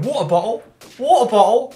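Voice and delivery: dropped T's, "Water bottle" as "wa'er bo'le", rising high and loud; hammed-up English glottal stops on "water bottle"; exaggerated English accent. Mocking his own English accent for American friends, hammed up. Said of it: "us English don't pronounce our T's right".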